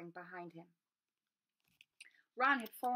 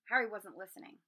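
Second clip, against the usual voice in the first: usual speaking voice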